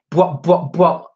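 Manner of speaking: imitated bass hits; mock hip hop. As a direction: mouth the beat of West Coast hip hop.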